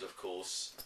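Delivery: ordinary delivery, nothing marked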